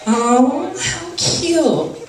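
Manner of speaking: put-on airy high girlish coo; girly "how cute". Girl reacting to a guy, high mock coo. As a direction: coo it like a girl friend-zoning you. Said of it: "not good when a girl does this".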